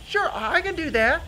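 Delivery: high-pitched mouse reply; agreeable Mister Mouse. Acted. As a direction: agree in a squeaky voice.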